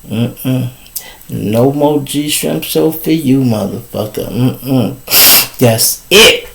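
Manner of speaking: low, soft, done with him; a hint of a fed-up woman dismissing him; low mock disapproval; dismissive. Friend disowning the man, dismissive.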